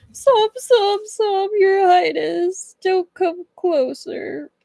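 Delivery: high, slow, sobbing little voice pleading; crying plea. Acted. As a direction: whimper it out like a scared merman begging him away. Liu cries, hiding in water.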